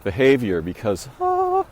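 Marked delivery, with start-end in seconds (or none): 1.2-1.6 very high cooing Oh; a soaring, tender Oh for an animal; talking to animals, a very high coo; high-pitched animal voice. Shown as a demonstration. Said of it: "my high-pitched animal voice".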